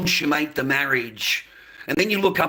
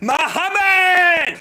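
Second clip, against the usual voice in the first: long, high, drawn-out yell; 12 semitones higher, 6 dB louder, much slower than usual. Acted. Streamer showing how he'd call down the road, yelling. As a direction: holler it down the street, stretching the last syllable. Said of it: "yell out fucking Muhammad".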